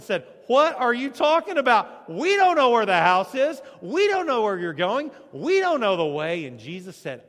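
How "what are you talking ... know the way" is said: loud, higher, exasperated disbelief; loud outburst from a baffled disciple; doubting Thomas loudly objects that he doesn't know the way; Thomas protesting. Retold or imitated.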